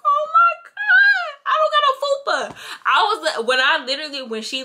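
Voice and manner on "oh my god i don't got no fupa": very high, loud, stretched squeal of joy; high excited shriek of happy shock; shrieking delight. Retold or imitated. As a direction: squeal it like you just saw your flat stomach. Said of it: "girl, I was like".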